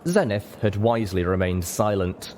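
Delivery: usual speaking voice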